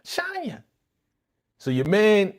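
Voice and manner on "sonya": "Sonya" mimicked very high and softer, SAHN-ya; mock her call of "Sonya" with an AH, high-pitched; mispronounced name call. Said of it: "Why is she calling her Sonya".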